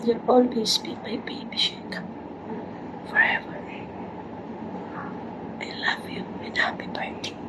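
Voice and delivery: tender whispered goodbye; whispering. Real, not acted.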